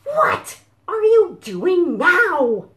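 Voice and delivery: high, loud, slow outraged question; exasperated chicken. Acted. Chicken Sofa confronts the dancing cows, outraged. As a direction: demand it in disbelief.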